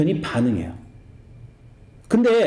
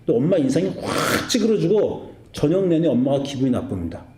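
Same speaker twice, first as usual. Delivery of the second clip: ordinary talk, nothing marked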